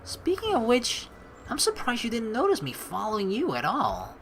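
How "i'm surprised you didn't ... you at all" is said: ordinary talk, nothing marked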